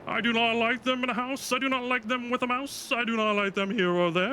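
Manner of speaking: hammed-up Dr. Seuss read-aloud; reading to children. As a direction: bounce through the rhyme like a kids' story hour. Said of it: "Doctor Seuss read a book".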